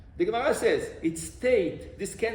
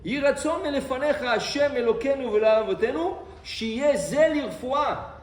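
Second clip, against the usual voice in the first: raised, fast Hebrew recitation; recited prayer; 5 semitones higher, 4 dB louder, much faster than usual. Shown as a demonstration. Rabbi showing the prayer before medicine, fast raised recitation. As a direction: rattle off the prayer, pitched up. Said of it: "you have to say a prayer".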